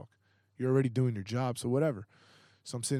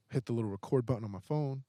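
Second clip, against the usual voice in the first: ordinary talk, nothing marked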